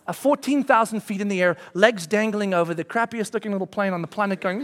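ordinary talk, nothing marked

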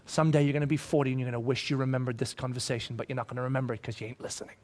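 fast, low muttered thought; inner grumble. Pretend. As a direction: grumble it under your breath. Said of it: "I'm thinking to myself".

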